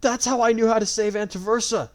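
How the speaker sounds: high, loud anguished cry. A narrator's reading. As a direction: cry it out in despair. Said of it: "she cried".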